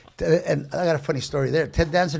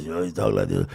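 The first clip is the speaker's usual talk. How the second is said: much lower, put-on voice; trained low voice. Shown as a demonstration. Showing hosts the deep voice he had to learn. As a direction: drop the voice way down. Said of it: "they wanted me to talk like this".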